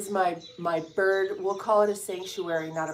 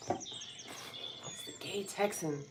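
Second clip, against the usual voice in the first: whisper; 13 dB quieter, much slower than usual. Real, not acted. Whispers crush's name again.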